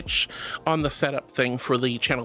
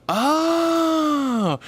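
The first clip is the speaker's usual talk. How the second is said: drawn-out "oh"; 8 semitones higher than usual. Real, not acted. Sympathetic reaction to chat's message.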